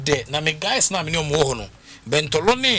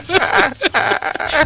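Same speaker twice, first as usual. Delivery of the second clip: a short high laugh; real laugh; 14 semitones higher, 4 dB louder than usual. Real, not acted. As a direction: laugh along with the host. Laughs, Ahmed laughs too.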